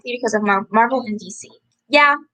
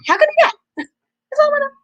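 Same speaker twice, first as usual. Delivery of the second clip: much higher, louder mock squeal; squeaky disbelief; 3 dB louder, much faster than usual. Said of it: "I'm like".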